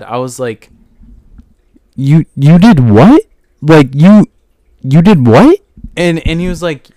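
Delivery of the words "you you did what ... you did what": loud stunned disbelief, dragged out slowly; shocked yelling. Retold or imitated. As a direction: blast the question in total disbelief. Retelling shocked reaction to customer.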